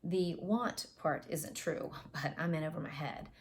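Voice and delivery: usual speaking voice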